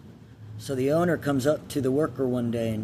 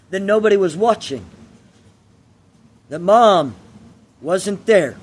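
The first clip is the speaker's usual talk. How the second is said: louder, higher, emotionally charged parable telling; parable storytelling; 6 semitones higher, 7 dB louder than usual. Acted. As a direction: tell the fable with swelling emotion.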